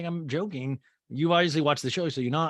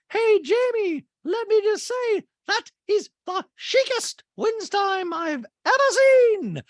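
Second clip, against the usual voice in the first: much higher, louder, slow put-on socialite voice; high loud slow caricature of a gushing rich woman; Dorit voice; 6 dB louder, slower than usual. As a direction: gush it in a high, loud mock-posh voice. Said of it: "And Dorit goes".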